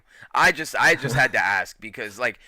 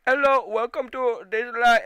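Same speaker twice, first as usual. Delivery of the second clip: high mock Indian call-centre accent; imitating phone operator; 10 semitones higher, 3 dB louder than usual. Mocking the Indian phone operator on a bad line, high put-on accent. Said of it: "so she'll be like".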